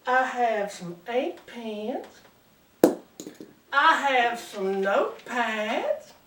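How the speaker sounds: slow, seductive Southern belle. Acted. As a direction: list the freebies slowly, as a flirty Georgia belle. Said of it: "good-looking Southern belle".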